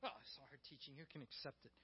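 low, very soft complaint; grumbling. Acted. Followers complain among themselves about hard teaching, grumbling low. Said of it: "And they start grumbling".

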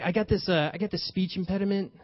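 ordinary talk

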